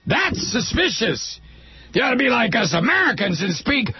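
high-pitched, outraged parody of a patriot; mock indignation. Mock American suspicious of Canadians.

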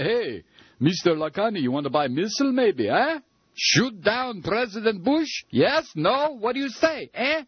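high, mocking Yiddish-accented pitch; hammed-up Yiddish accent, sales pitch. Mock Al-Qaeda agent pitching missile.

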